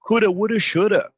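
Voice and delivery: "coulda, woulda, shoulda" with the have blurred to uh; reduced coulda. Shown as a demonstration. Teacher showing lazy casual contractions. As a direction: slur the have into uh. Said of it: "you can abbreviate".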